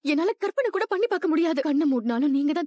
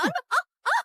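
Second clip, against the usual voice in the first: high cry of pain as she is pushed; 12 semitones higher than usual. Acted. Woman shoved out by husband, crying out high. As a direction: let out a sharp ah.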